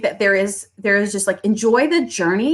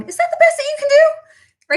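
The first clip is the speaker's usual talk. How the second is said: much higher, cartoonish mock voice; doctor mimicked. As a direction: play the doctor as a squeaky haggler. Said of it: "My doctor going".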